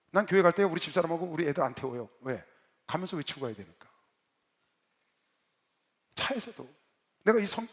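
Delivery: normal talking voice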